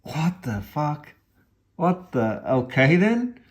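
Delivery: slow, stunned what the fuck rising high; high-pitched bewildered what the fuck; baffled shock. Retold or imitated. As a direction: act out a stunned double take. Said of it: "And it's like".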